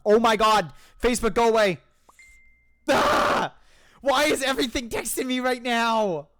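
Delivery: high, frustrated groaning complaint; exasperated outburst. Real, not acted. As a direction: yell at the phone to leave you alone. Frustrated by texts interrupting play.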